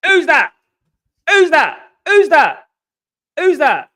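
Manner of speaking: high, loud repeated demand; insistent challenge. Real, not acted. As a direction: fire it out loud and high, falling sharply. Insistently showing his passport as proof.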